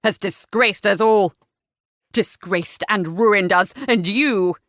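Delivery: heavy, scornful condemnation; spat. A narrator's reading. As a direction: spit out the accusation. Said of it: "spat Sir William".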